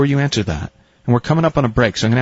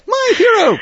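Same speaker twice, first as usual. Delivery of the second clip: very high, louder swooning cry; swooning damsel; 4 dB louder than usual. Retold or imitated. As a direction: swoon like a rescued heroine. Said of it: "it's an old melodrama".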